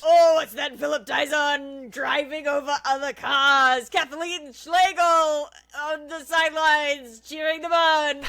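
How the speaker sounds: shouted hyped announcer; monster truck ad. Acted. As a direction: yell it over the engine noise. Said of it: "reading this as a monster truck advertisement".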